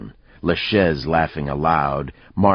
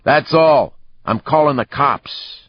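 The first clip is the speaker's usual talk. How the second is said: high, loud shout; bartender's yell; 10 semitones higher, 6 dB louder than usual. A narrator's reading. Bartender ending a brawl, yelling. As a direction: yell it as a final warning. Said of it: "The bartender yelled".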